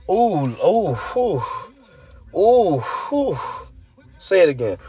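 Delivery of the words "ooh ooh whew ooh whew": high swooping ooh, then a whew; wowed ooh and whew. Real, not acted. Host recalling open mic night, wowed whooping sighs. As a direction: let out high, amazed oohs.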